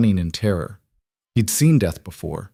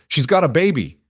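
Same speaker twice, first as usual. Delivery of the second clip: urgent shout; 7 semitones higher than usual. A narrator's reading. Cole pleading with Webb.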